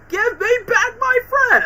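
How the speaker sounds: high, loud cry for his friend; desperate shout. Retold or imitated. Gamer imitating Peter yelling at Venom, high desperate shout. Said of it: "and then Peter's like".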